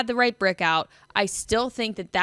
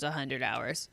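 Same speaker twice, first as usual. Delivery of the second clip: sarcastic, much softer and lower; air quotes; 8 semitones lower, 10 dB quieter than usual. Real, not acted. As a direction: say a hundred hours with air quotes. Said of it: "in quotations".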